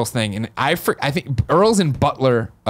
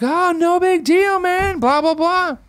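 put-on much higher, slow, easygoing old country guy; Earl impression; much slower than usual. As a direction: drawl it out like a laid-back old man. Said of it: "he's like".

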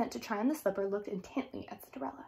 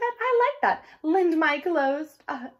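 haughty stepsister; 5 semitones higher, 5 dB louder than usual. Acted. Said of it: "said Javette".

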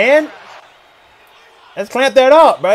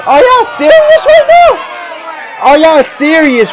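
high, slow, drawn-out cry of disbelief; stunned disbelief; 7 semitones higher, slower than usual. Real, not acted. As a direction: stretch it out in shock, way up high. Shocked fan watching team lose.

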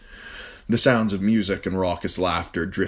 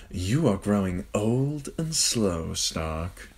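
slow mocking drawl; 3 semitones higher, much slower than usual. A narrator's reading. Littlefinger teasing.

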